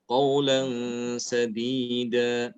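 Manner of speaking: slow recitation, nasal held at end of "qaulan"; ikhfa on tanwin. Shown as a demonstration. Teacher gives tanwin example, slow held nasal. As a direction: stretch "qaulan" into a hum before "sadida". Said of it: "Contoh yang tanwin".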